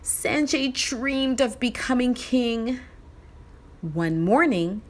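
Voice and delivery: usual speaking voice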